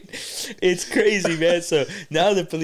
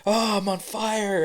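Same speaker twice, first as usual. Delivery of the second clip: higher, drawn-out mock wail; distressed woman; 6 semitones higher, slower than usual. Retelling the sunburned woman's complaint, mock wail. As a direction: imitate a woman moaning in distress, slowly. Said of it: "she's just like".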